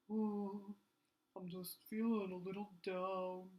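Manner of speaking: mopey puppet sigh; sad puppet. Shown as a demonstration. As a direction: let the voice sag with the body. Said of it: "when people feel sad".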